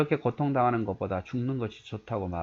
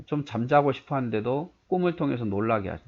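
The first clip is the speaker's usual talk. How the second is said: ordinary delivery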